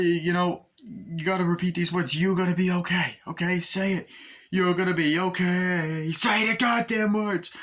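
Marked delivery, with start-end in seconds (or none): 2.1-6.1 insistent reassurance. Acted. Gunman to his wounded partner, insistent reassurance. Acting out film's reassurance scene. 6.1-7.5 high, drawn-out order; insistent demand. Acted. Gunman pushing wounded partner to repeat words, insistent demand.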